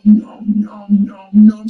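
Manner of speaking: loud mock nom nom munching; loud eating noises. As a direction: chomp loudly like a kid in cake. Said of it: "She sound like a fat kid in some cake".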